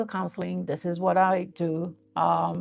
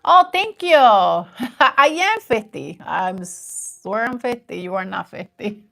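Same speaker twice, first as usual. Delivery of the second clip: usual speaking voice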